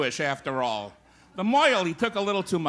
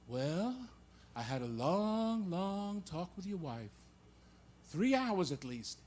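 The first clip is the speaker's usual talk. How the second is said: slow, soft rabbi on the phone; weary rabbi; 11 dB quieter, slower than usual. Acted. Rabbi phoning the husband about his wife, slow and soft. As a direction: draw it out quietly, like a long-suffering rabbi. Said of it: "the rabbi telephones the man".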